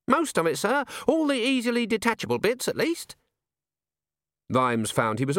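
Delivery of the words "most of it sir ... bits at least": put-on higher, earnest young dwarf; eager young recruit answering his boss, pitched up; a young dwarf explains his blunder to the commander, eager and high; young dwarf alchemist.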